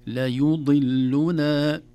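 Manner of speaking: ليضلنا, each vowel separate and slow; careful syllables. Shown as a demonstration. Teacher drills ليضلنا slowly for beginners. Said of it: "الضم والكسر ثم الضم".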